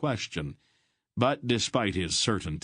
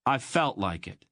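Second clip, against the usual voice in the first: a touch of defiance; half defiant; 5 semitones higher than usual. Acted. Boy answering his aunt, half defiantly. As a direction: toss it back, half daring her. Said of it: "half defiantly".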